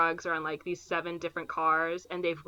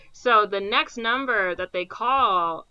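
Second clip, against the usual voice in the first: ordinary delivery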